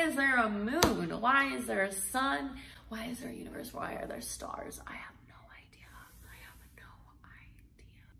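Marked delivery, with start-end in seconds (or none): 2.9-8.1 softer and softer until only a whisper; drifting off into a breathy whisper of doubt; fading to a whisper. Real, not acted. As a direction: let the questions die away to a whisper. Trails off pondering existence.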